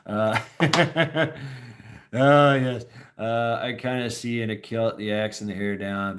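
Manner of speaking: normal talking voice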